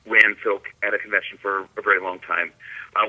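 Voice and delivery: ordinary delivery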